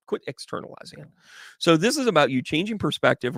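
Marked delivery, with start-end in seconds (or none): 0.1-1.1 quick, soft whispered aside. Real, not acted. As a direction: whisper it fast under your breath.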